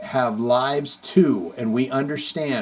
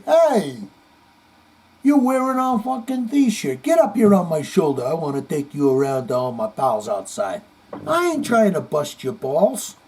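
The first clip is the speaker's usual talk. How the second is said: tough New York guy; 2 semitones higher than usual. Retold or imitated. [name] greeting [name] in a brash New York accent. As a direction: ham up a brash Brooklyn rocker greeting a fan. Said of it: "he'd say".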